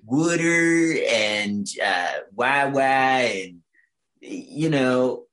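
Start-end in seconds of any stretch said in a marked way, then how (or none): 0.1-2.8 very slow, stretched stab at a Philly accent; Philly accent attempt. Shown as a demonstration. Trying out the only two Philly words known, slow accent. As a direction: draw out each word in a hesitant Philly accent. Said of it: "a very hard accent to replicate".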